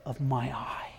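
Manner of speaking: soft, near-whispered endearment; tender voice of God. Shown as a demonstration. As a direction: hush it like a father to a sleeping child. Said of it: "It is a language of affection".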